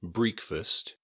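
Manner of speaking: "breakfast" said as BREEK-fast, long ee first; mispronounced first vowel. Shown as a demonstration.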